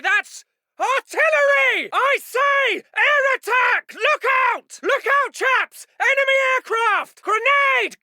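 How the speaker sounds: very high, drawn cries of danger; alarmed warning shout. Acted. Officer warning chaps of artillery and grenades, panicked shout. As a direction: yell for everyone to take cover.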